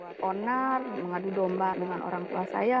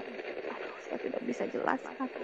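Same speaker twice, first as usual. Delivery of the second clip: breathless, hushed whisper; words fail in awe; awed whisper; 9 dB quieter than usual. Real, not acted.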